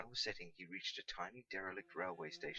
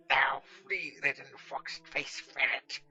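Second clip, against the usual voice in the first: loud, slow furious curse; cursing; 4 semitones higher, 13 dB louder, much slower than usual. Acted. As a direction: spit out the insult. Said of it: "cursed Catweasel".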